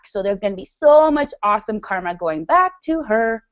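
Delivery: ordinary talk, nothing marked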